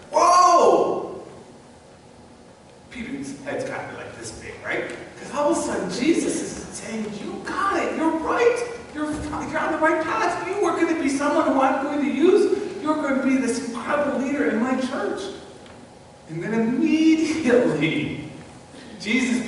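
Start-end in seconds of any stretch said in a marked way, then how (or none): 0.1-1.2 loud, high, drawn-out whoa; acted astonishment, pitched up; amazed whoa. Acting amazement at Peter's honour. 7.2-15.2 high-pitched, mildly excited acclaim; acted encouragement, pitched up; excited praise. Voicing Jesus praising elated Peter.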